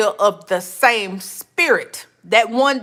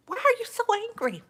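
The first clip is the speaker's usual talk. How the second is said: mock question; 9 semitones higher, 8 dB quieter, faster than usual. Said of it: "I'm like".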